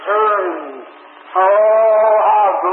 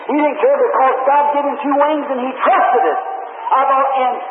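raised, high-pitched sermon climax; full-force preaching; 5 semitones higher than usual. Real, not acted. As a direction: build into fervent, high preaching.

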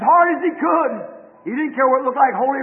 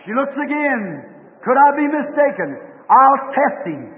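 normal talking voice

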